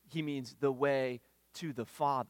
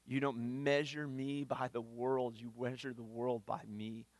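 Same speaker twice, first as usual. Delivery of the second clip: usual speaking voice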